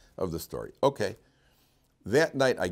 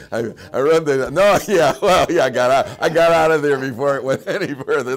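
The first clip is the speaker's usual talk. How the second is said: laughing while telling; 6 semitones higher, 12 dB louder than usual. Real, not acted. Laughing while escaping the room.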